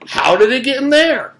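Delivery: put-on high, loud baffled kid; bewildered. A narrator's reading. Mac asks the class, high and bewildered. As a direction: throw it out in disbelief. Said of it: "held out his arms in bewilderment".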